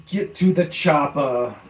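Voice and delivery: slow, deliberate mock action-hero delivery; lightly voiced tough-guy movie catchphrase; action-hero movie quote. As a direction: ham up the famous chopper line. Quoting a famous action-movie line.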